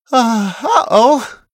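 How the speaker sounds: slow, high, drawn-out uh-oh; dismayed uh-oh. A narrator's reading. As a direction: realise mid-sentence that it went wrong. Character realises something went wrong.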